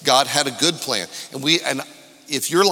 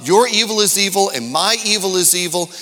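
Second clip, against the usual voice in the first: rising, louder preacher's cadence over music; preaching at full force; 6 semitones higher, 5 dB louder than usual. Acted. Pastor to his new church, preaching louder and higher. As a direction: lift the room, preach it out.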